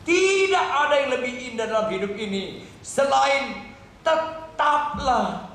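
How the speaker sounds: high, slow, stretched proclamation; full-force preaching. Real, not acted. Preacher urges believers to abide in God, fervent preaching. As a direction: proclaim it with long, ringing emphasis.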